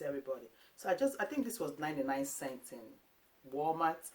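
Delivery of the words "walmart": ordinary talk, nothing marked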